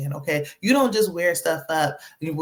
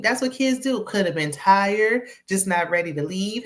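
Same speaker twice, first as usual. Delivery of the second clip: ordinary talk, nothing marked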